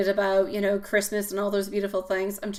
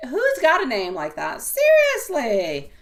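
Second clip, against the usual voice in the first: loud, high, drawn-out disbelief; exasperated, raised-voice incredulity; incredulous; 5 semitones higher, 7 dB louder than usual. Real, not acted. Incredulous about a rival's name.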